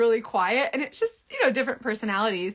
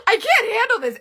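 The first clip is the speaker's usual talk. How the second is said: exasperated; 12 semitones higher, 6 dB louder than usual. Retold or imitated. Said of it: "And I'm like".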